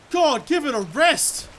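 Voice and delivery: loud, high, drawn-out complaint; exasperated yell. Real, not acted. Player fed up with the crocodile still coming, exasperated.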